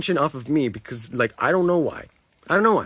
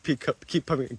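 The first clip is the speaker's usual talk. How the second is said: ordinary talk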